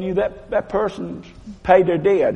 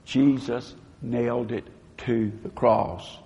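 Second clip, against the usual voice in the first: a hushed confession of faith; quiet declaration; 5 semitones lower, 8 dB quieter than usual. Shown as a demonstration. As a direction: say it softly, from the heart. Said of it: "Just say this in your heart".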